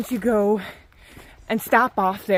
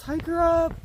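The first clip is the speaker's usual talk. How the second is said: retold shout; 7 semitones higher than usual. Hiker calling out that someone is coming up, mock shout. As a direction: imitate a trail call at talking volume. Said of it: "he shouts".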